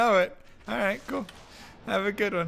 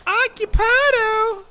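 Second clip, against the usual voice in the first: calling out; 8 dB louder, slower than usual. Retold or imitated. Answering knocks on bathroom door.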